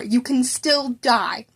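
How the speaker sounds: drawn out, heavy warning; slow grave warning. Acted. Teacher warning his student, slow and grave. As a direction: spell it out firmly.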